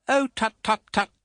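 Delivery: put-on high, hasty tut-tut from a stern old lady; hurried tutting. A narrator's reading. Aunt hastily hushing the crying widow, high and brisk. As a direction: brush the tears away briskly. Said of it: "said Miss Betsy in a hurry".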